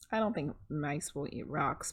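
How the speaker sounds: plain reading voice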